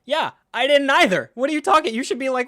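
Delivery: high, loud incredulous rant; exasperated outburst. Acted. Fan ranting at the characters' tame reaction, exasperated yelling. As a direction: yell back in disbelief.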